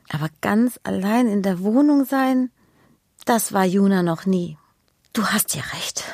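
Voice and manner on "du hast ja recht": hushed whisper. A narrator's reading. Narrator whispering to the listening children. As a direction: lean in and whisper the question.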